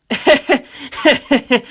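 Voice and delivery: a quick light laugh; breezy chuckle before reassuring him; amused chuckle. Acted. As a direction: laugh it off casually. John laughs at the question.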